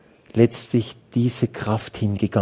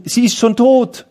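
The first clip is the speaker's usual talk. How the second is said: messengers announcing death; 10 semitones higher, 5 dB louder than usual. Acted. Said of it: "sie kommen schon".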